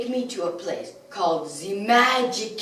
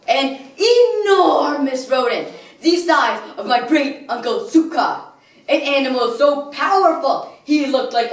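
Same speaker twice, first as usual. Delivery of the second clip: raised, booming theatrical reverence; grand awe; 4 semitones higher, 4 dB louder than usual. Acted. Villain describes the rodent he worshipped as a child, awestruck. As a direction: build up the creature like a mighty god.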